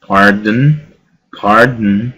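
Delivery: "pardon" said as "pard-n", D running straight into N; vowel dropped in pardon. Shown as a demonstration. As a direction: leave out the O in "pardon", rising at the end. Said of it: "Es la misma situación con la número tres".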